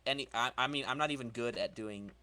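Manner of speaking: ordinary talk, nothing marked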